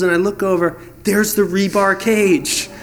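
normal talking voice

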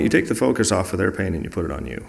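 ordinary talk

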